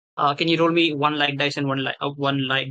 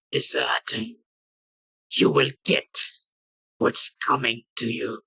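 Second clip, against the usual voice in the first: slow, lightly raspy undead voice; a hint of rasp, spacing out the words ominously; raspy corpse voice; slower than usual. Corpse grabs the adventurer's hand to accuse, lightly raspy. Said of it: "in a very raspy voice".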